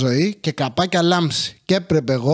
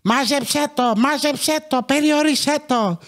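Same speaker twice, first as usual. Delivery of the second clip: put-on high, shrill-sounding old grandmother, pitched way up; grandmother's nagging; 7 semitones higher than usual. Grandmother nags the mother to keep the child in, high-pitched. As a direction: nag like a bossy grandma. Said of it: "Και της έλεγε".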